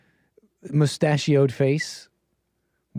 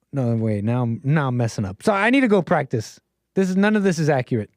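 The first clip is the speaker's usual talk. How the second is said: ordinary talk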